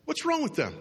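high, falling, judgmental question; mock indignation. Acting out being snubbed in the building, mock indignant. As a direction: blame them in a high-pitched mock huff.

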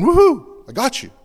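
high, loud whoop of triumph, then a taunt; gloating enemy. Acted. The enemy crowing over two quarreling Christians, loud gloating whoop. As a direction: crow like the enemy who just caught you. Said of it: "and he's going".

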